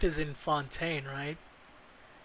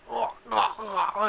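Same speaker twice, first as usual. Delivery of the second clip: a hammed-up dying man's groaning oh's, a bit higher and louder; mock dying groans; 6 semitones higher, 4 dB louder, slower than usual. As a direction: mimic the dead man's last gasps, half joking. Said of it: "He just said".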